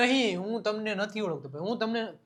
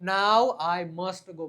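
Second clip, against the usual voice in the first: slow model sentence; 3 semitones lower, much slower than usual. Shown as a demonstration. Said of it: "must વાપરવામાં આવે છે".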